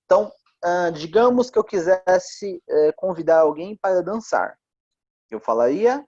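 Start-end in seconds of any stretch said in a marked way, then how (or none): none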